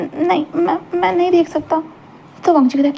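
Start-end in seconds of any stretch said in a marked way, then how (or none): 0.0-1.8 shy soft refusal. Acted. Embarrassed Wing refuses to look.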